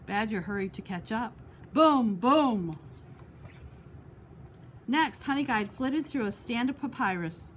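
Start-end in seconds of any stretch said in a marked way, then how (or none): 1.7-2.8 loud heavy booms; booming. A narrator's reading. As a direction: stomp it out like drumbeats. Badger on hollow log.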